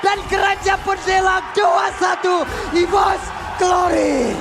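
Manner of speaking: live play-by-play. Real, not acted. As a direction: shout the win to the arena. Play-by-play on the game-winning push. (komentator live berteriak heboh)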